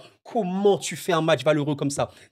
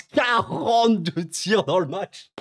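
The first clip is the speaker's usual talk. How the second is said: "Quarante-deux tirs" stretched out slowly and a bit higher; slow disbelief; 4 semitones higher, slower than usual. Shown as a demonstration. Stat répétée pour insister. (lent, incrédule, sur "Quarante-deux")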